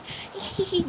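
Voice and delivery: high mock giggle; imitated giggle. Acid girl with fresh gossip, imitated giggling.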